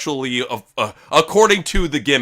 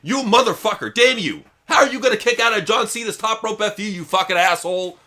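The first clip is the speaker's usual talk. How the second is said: furious outburst; 8 semitones higher than usual. Real, not acted. Fan cursing Cena for kicking out, real anger. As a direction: let the frustration explode at the kickout.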